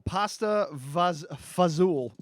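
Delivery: Italian-American fazool, slow; pasta fazool in Italian-American accent. Retold or imitated. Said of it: "this is how Goombas pronounce this".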